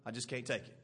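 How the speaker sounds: soft, low, worn-out surrender; weary giving up. Pretend. Judas quitting on Jesus, soft and spent. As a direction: quietly give up on it all. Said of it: "Judas said".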